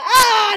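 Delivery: loud, high yell on getting caught; startled yell. Real, not acted. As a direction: yelp loudly in alarm. Caught in a net.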